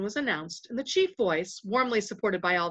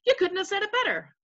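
high, quick cheerful chorus; cheerful agreement; 12 semitones higher, faster than usual. A narrator's reading. Voices praise the speaker, high cheerful agreement. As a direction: praise eagerly in a much higher voice. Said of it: "said the voices cheerfully".

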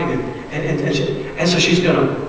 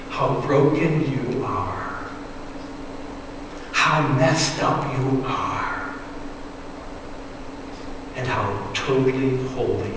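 tender appeal; 3 semitones lower, 8 dB quieter than usual. Acted. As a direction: draw them gently inward. Searching question to congregation.

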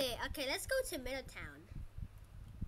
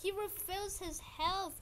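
usual speaking voice

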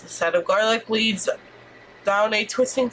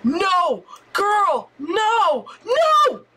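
shouting no; 9 semitones higher, much slower than usual. Real, not acted. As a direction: yell at them to stop, rising higher. Shouting at game characters.

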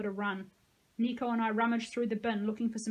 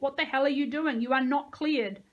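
loud, higher angry challenge; shouted; 4 semitones higher, 6 dB louder than usual. A narrator's reading. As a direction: tower controller yelling over the radio. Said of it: "shouted the voice on the headset".

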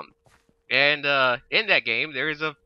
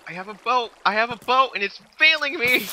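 high, exasperated outcry, getting louder; frantic; 10 semitones higher, 4 dB louder than usual. Real, not acted. Player whose boat is failing during an escape, frantic. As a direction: urge it on, then complain in rising frustration.

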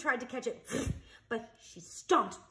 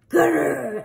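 mock growl; 5 semitones higher, 8 dB louder than usual. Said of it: "He growled".

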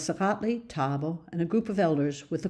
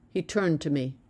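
ordinary delivery

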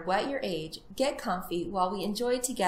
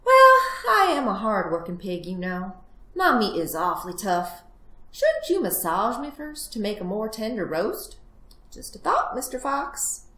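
cunning little piglet making excuses; wheedling piglet; 2 semitones higher, 5 dB louder than usual. Acted. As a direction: coax the fox with feigned innocence. Plays the piglet.

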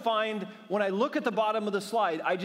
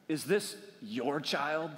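slow, low, softer mock condescension, judging each word; condescending mom; 3 semitones lower, 4 dB quieter, slower than usual. A rescuing mom to the dad, mildly mocked condescension. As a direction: drag it out with lightly mocked judgment. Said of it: "appropriate condescension, like, and judgment".

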